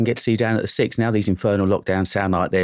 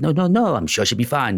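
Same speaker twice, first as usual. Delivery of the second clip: mock French accent that slides toward Italian; a badly faked French accent, pitched up at the start; terrible French accent; 6 semitones higher than usual. Host imitating guest Clement in a bad French accent. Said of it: "That was a terrible French accent".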